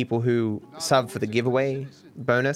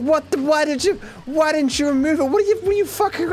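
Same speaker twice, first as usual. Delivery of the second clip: squeaky high panicked yelling; panicked kid. Retold or imitated. Little kid screaming at grandpa about the huge spider, panicked high yell. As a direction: shriek it like a terrified little kid. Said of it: "I'm a little kid and I'm freaking out".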